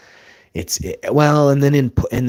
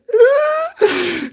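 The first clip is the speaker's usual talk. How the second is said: big high shrieking laugh bursting out; loud laugh; 8 dB louder than usual. Real, not acted. Telling friends about the match, loud high laugh. Laughing at his own wrestling win.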